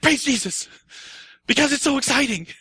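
joyful race-day shouts of praise; excited cheering. Retold or imitated. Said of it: "my wife does races".

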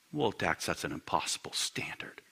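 low, very soft protest; muted objection. Acted. Congregant objecting to the preacher, hushed and low. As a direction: object quietly, sinking almost to a whisper.

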